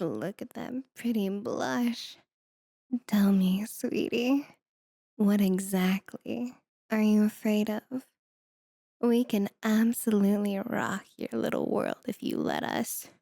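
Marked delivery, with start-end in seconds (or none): 3.1-8.0 breathy, secretive whisper; teasing whisper. Acted. Demon leaning in to tease the mage, whispered. 9.0-13.1 seductive whisper. Acted. Demon seducing the mage.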